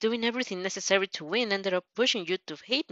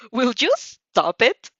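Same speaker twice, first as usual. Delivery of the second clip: slow, louder, fed-up "Will you stop it?"; angry outburst; 4 semitones higher, 6 dB louder, slower than usual. Acted. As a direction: snap at him to cut him off, pulling each word out. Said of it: "at your outburst".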